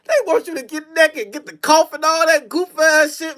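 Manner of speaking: normal talking voice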